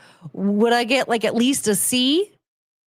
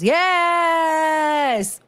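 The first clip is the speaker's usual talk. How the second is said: excited cheer; 7 semitones higher than usual. Real, not acted. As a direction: stretch it into a triumphant cry. Excited celebration of the silly pun.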